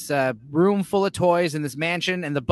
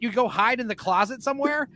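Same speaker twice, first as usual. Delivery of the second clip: usual speaking voice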